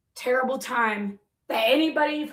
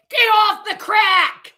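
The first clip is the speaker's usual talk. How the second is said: mock shout; 6 semitones higher, 7 dB louder, slower than usual. Reading a hostile comment aloud.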